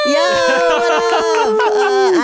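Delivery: loud, high, drawn-out hype yell; hype greeting. Acted. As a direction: call it out like a big entrance. Entrance greeting.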